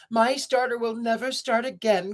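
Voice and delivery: put-on high, wailing old car; tearful cry. A narrator's reading. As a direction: cry it out, hopeless. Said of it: "cried the little old car".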